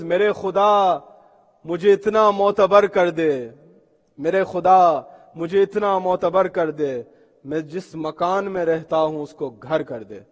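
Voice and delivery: slow, loud Urdu verse, easing at the end; poetry recitation. Acted. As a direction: declaim the couplet with drawn-out line endings.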